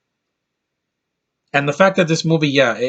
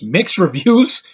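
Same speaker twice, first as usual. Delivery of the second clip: slow, higher disbelieving question; drawn-out mock disbelief; incredulous; 5 semitones higher, 3 dB louder, slower than usual. Real, not acted. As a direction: scoff at the idea of mixed reviews. Scoffing at a review quote.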